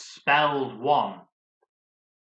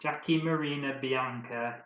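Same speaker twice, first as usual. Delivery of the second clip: ordinary delivery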